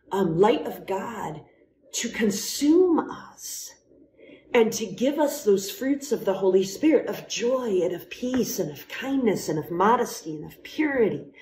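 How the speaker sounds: usual speaking voice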